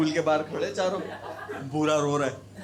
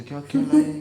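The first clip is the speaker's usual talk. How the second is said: surprised buddy; 10 semitones higher, 10 dB louder than usual. Retold or imitated. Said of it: "अबाकादर बोले".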